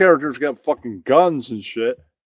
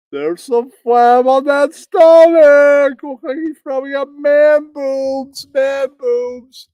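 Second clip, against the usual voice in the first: much higher, louder and slowed mockery; incredulous mocking; 9 semitones higher, 6 dB louder than usual. Real, not acted. Podcaster gleefully pointing out the streamer's flab. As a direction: stretch it out in gleeful disbelief. Mocking the buff guy's stomach.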